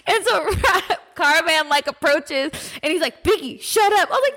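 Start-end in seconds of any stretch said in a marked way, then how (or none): 3.2-4.1 higher-pitched mock reprimand; retold scolding. Partner approaches the barking dog, higher mock scolding. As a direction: imitate someone telling off the dog.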